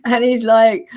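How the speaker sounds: ordinary talk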